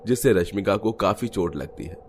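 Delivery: ordinary delivery, nothing marked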